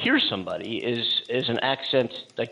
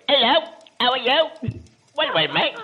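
higher, louder hammed-up British accent; mock British accent; 14 semitones higher, 7 dB louder than usual. Host mocking South Africans who sound British. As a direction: play a jolly caricature of a British bloke. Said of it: "I don't hear any South Africans going".

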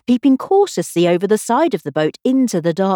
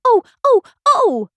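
high, louder short startled cries; little shrieks; 13 semitones higher, 4 dB louder than usual. Acted. Alice shrieking as the oar handle strikes her. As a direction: yelp as the oar knocks her. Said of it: "a series of little shrieks".

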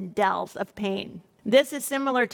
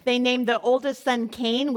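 ordinary talk, nothing marked

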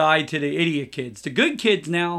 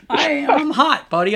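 laughing; 10 semitones higher, 5 dB louder, slower than usual. Real, not acted. Laughing about sweating on roof.

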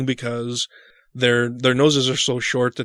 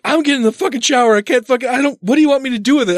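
higher, very fast frantic; 9 semitones higher, 3 dB louder, much faster than usual. Retold or imitated. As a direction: rattle it off in a panic. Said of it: "And I'm like".

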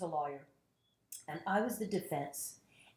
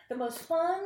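normal talking voice

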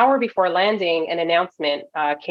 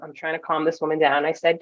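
ordinary delivery

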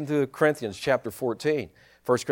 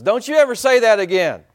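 angry owner; 6 semitones higher, 8 dB louder than usual. Acted. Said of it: "He says".

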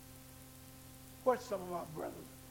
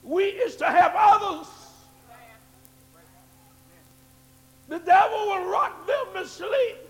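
louder, higher preacher's build; preaching at full force; 8 semitones higher, 14 dB louder than usual. Acted. Pastor urging the church to wake others, in a rising preaching shout. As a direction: hammer it at the congregation.

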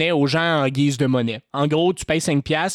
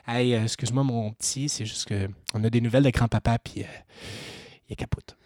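put-on gentle voice to child; 6 semitones lower, 7 dB quieter, faster than usual. Gentle bad news to a child.

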